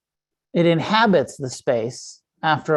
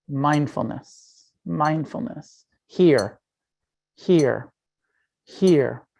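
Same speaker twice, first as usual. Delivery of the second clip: slow drip-like repetition; 2 semitones lower, much slower than usual. Shown as a demonstration. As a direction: space the words out like water dripping on stone. And you were dripping water on it.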